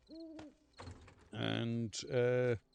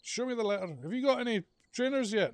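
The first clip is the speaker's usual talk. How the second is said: high, loud, fast demanding teacher; teacher demanding the boy's letter; 12 semitones higher, 6 dB louder, much faster than usual. Retold or imitated. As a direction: rattle it off like a stern teacher. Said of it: "the P E teacher would say".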